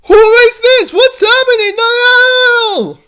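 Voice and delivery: very high, loud, sobbing panic; terrified crying. Acted. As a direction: wail the no's in rising terror. Kidnapped, terrified.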